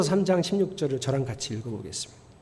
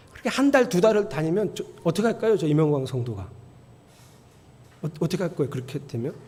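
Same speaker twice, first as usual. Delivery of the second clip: usual speaking voice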